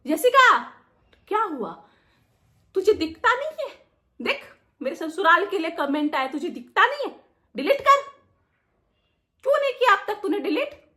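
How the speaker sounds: high, loud scolding demand; shrill-edged telling off, loud and high; angry scolding. Retold or imitated. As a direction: snap at her to delete it now. Friend scolding her over comments.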